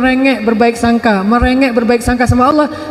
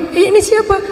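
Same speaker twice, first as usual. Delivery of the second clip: surprised exclamation; 8 semitones higher than usual. Retold or imitated. Musa surprised at the grandmother.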